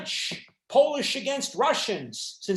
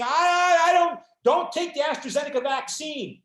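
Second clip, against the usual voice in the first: higher and louder, an indignant refusal, hammed up; mock indignant shout; 5 semitones higher, 5 dB louder than usual. Lecturer voicing an offended German rejecting the British vaccine, mock indignant shout. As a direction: shout it like an insulted nationalist refusing the jab.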